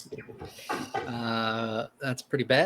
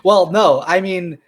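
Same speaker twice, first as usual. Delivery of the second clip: loud; 6 semitones higher, 13 dB louder than usual. Real, not acted. Loud protest after laughing.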